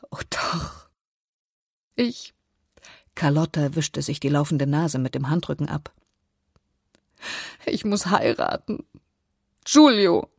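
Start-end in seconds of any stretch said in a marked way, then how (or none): 0.1-2.3 faint whispered reply that breaks off; tearful. A narrator's reading. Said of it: "Um ihr Schluchzen zu dämpfen". 7.7-10.3 distraught, higher-pitched outburst on the name; tearful confession. A narrator's reading. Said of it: "Um ihr Schluchzen zu dämpfen".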